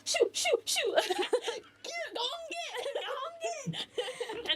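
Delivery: high, laughing, slow shooing; shooing old lady. Acted. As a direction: shoo them off in a squeaky giggle. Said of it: "you just hear her go".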